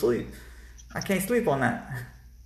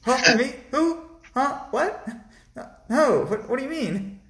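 high-pitched, mock-suspicious accusing voice; imitated indignant nurse, pitched way up; alarmed nurse; 10 semitones higher than usual. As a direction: squeal startled questions like a nurse catching you out. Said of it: "is not gonna be like".

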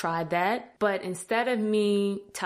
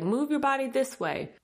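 high-pitched coaching call, only mildly raised; retold shout; 7 semitones higher than usual. Fellow players coaching a beginner, mild retold shout. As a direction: call it out high, without real volume. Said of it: "They even shouted out".

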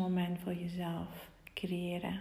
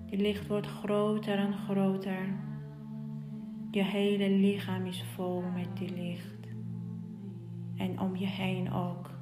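guided meditation. Acted. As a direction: guide them gently into the light.

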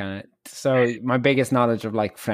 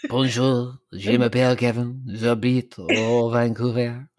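halting amateur French accent, last words drawn out; slower than usual. Shown as a demonstration. Host shows the guest their French, in an amateur French accent. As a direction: have a go at a schoolbook French accent. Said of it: "I can do it in a French accent".

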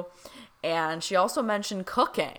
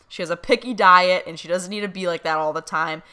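teasing quote; 7 dB louder, faster than usual. Retold or imitated. Dad teasing via medium.

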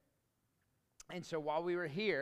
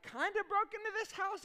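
high, disbelieving repeat; incredulous; 14 semitones higher than usual. Retold or imitated. Husband repeating his wife's words in disbelief. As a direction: echo it back in shock. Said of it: "incredulous echo".

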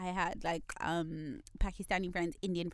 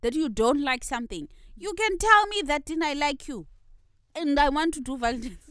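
higher and louder, fired-up rant; revved up; 4 semitones higher, 5 dB louder than usual. Real, not acted. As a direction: get worked up and push every line. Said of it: "Dean is so revved up".

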